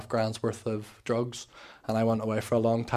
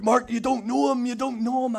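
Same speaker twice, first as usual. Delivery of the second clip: pleading; 12 semitones higher, 6 dB louder than usual. Retold or imitated. Pleading with brother Mark.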